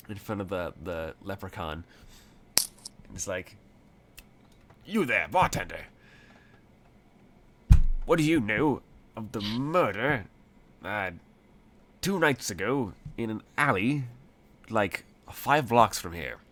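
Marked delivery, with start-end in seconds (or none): 4.9-16.4 blustering gentleman addressing a bartender, swooping pitch; gentlemanly bravado. Acted. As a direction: put on a gentleman's bold, questioning voice. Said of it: "hold on to his gentlemanly bravado".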